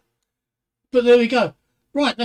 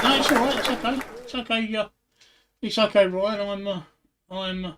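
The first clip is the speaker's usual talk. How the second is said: usual speaking voice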